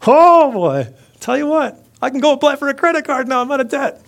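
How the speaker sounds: high, loud, gleeful singsong; giddy skipping debtor. Acted. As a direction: crow it in a comic high voice, carefree. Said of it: "probably hopping and skipping down the road".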